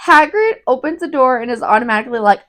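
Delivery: ordinary talk, nothing marked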